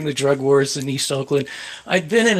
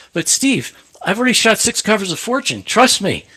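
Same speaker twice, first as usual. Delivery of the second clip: higher-pitched retold appeal; earnest acted-out protest, voice raised in pitch; pleading insistence; 7 semitones higher than usual. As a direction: plead your case against an angry boss. Retold argument, pleading his case.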